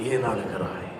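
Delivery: soft low voice of God; hushed tender question. Acted. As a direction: murmur it gently like God to a weeping child. God voiced asking why.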